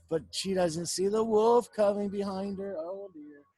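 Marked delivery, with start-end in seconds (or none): none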